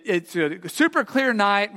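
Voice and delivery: usual speaking voice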